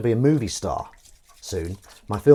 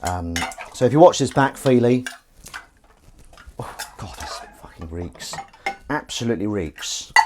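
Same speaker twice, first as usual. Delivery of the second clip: ordinary talk, nothing marked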